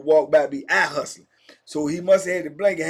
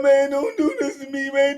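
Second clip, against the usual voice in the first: scared begging; 8 semitones higher than usual. Retold or imitated. Begging for life.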